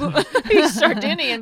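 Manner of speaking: laughing. Real, not acted. Laughing at accent.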